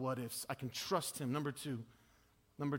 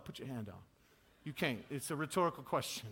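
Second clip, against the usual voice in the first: usual speaking voice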